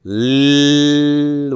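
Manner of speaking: "l-" held long on one note, lllll; sustained consonant. Shown as a demonstration. Teacher prompting the next syllable, sustained consonant. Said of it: "how are we gonna pronounce this".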